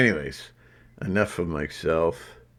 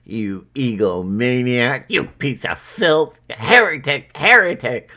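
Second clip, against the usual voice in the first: hammed-up accuser, getting higher and louder; mock denunciation; 4 semitones higher, 5 dB louder than usual. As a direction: hurl mock insults, building in pitch and volume. Acting out accusers' insults at him.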